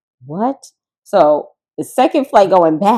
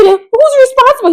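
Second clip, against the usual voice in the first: high, loud, slow panicked demand; retold panic; 8 dB louder, slower than usual. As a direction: plead in alarm. Panicked question on plane.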